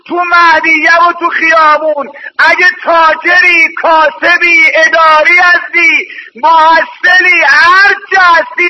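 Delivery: ordinary delivery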